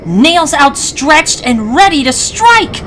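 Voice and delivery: ordinary delivery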